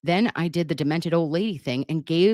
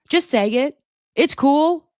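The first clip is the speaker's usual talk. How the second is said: pitched way up, loud and eager; high excited urging; 9 semitones higher, 4 dB louder than usual. Real, not acted. Urging Irish Meemaw to say it, high and eager. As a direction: egg them on, voice soaring. Urging viewer to speak openly.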